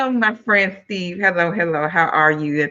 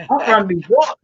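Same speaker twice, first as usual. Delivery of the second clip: imitating a cocky New Yorker, raised pitch; big New York attitude; 4 semitones higher, 3 dB louder than usual. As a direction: say it with mock New York swagger. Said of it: "he always had the biggest attitude".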